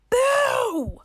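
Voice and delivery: loudest, highest BOO yet; all-out shout. Acted. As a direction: scream boo with everything. Third, biggest boo.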